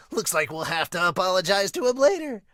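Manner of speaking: bright, cheerful reread. Acted. Re-reads Luffy line.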